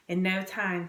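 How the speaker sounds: usual speaking voice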